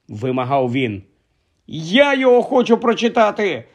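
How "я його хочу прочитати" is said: higher, insistent angry demand. Acted. Harry angrily demanding his letter from uncle. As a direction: demand it hotly. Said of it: "розгнівався Гаррі".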